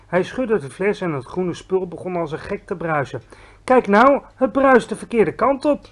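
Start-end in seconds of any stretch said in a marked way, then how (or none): 3.6-5.9 put-on a higher, louder girl's cry of surprise; surprised call. Sophie spotting the bubbles go down, surprised call. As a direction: call out, amazed. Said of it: "riep Sophie".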